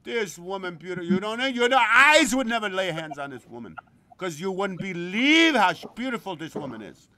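loud, boasting about a woman; lightly voiced excited friend; imitating Gino. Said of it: "I'll give you another example".

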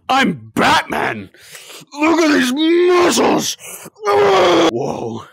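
Batman voice. Pretend. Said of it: "I'm Bruce Wayne".

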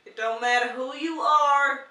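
slow, loud mock Southern preacher; hammed-up Southern sermon; Southern American accent. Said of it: "like Southern American".

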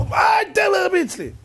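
high, loud outraged yell; mock-outraged cry at being hit; acted high-pitched protest; indignant shout. Choking child's rescuer thinks he's hit.

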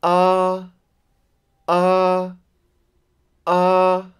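AH held high and level; long, high, unbending AH each time. Shown as a demonstration. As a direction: sustain each AH on one high pitch. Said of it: "call it as a high tone".

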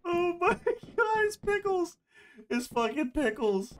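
high, slow, laughing delight; laughing excitedly. Real, not acted. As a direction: squeal it through laughter. Overjoyed at found item.

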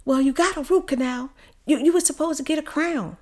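a hint of an accusing dentist; blaming dentist. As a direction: push the blame back on her. Dentist blames her.

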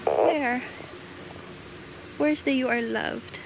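ordinary talk

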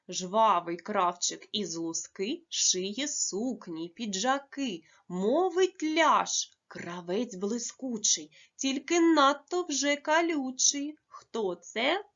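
slow, lively riddle reading with rising lines; riddle recital. Acted. Teacher reading a riddle to pupils, slow and playful.

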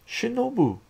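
high, loud call of her name. A narrator's reading. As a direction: call to the girlfriend in alarm. Hero calls to his girlfriend.

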